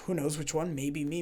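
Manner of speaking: ordinary talk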